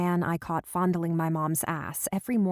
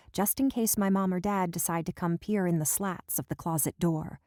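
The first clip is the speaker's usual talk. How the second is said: ordinary delivery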